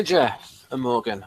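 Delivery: normal talking voice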